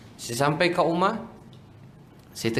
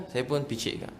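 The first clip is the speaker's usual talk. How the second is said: ordinary talk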